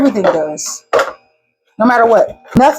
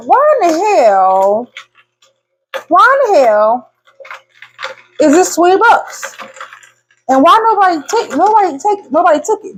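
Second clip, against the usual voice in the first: mock outrage; 7 semitones higher than usual. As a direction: replay baffled indignation at the price. Retelling outrage at melon price.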